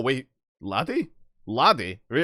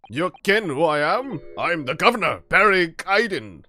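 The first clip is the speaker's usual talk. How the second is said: loud, puffed-up governor; a swaggering prison governor announcing himself; flexing tough guy; 3 semitones higher, 6 dB louder, slower than usual. Acted. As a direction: boom it out like a strongman showing off. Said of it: "like a flexing guy".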